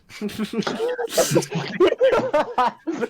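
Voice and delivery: big burst of high-pitched laughter; loud high cackle. Real, not acted. Player cracks up at the attack, cackling loudly.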